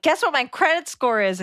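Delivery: eager, high-pitched brag; bursting to share the news; excited. Retold or imitated. Excited to tell sleeping parents.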